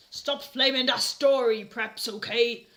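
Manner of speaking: loud scolding, okay slowed; angry author's note. Acted. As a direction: scold the haters.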